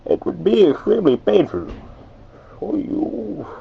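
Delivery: high, swooping villain voice; masked villain lilting up and down high in pitch; Bane. Shown as a demonstration. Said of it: "up and down with that".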